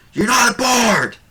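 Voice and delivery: high, loud heckler's jeer; heckling halfling. Acted. Halfling heckler in the audience shouting at the bard, high and loud. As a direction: yell it from the back of the crowd. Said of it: "a halfling from the audience go".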